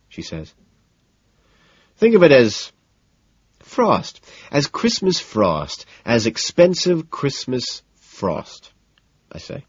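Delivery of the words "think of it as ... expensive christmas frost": slow, teasing. A narrator's reading. Patrick's sly drawn-out pitch.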